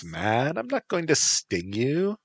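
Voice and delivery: put-on high breezy Valley Girl. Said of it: "said a Valley Girl voice".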